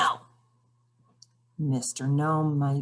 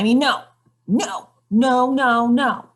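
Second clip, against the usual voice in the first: louder, higher shouted no's; grumpy gnome shouting at the reader; 7 semitones higher, 7 dB louder, slower than usual. Acted. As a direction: blurt out a stubborn string of no's. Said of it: "there's no need to shout".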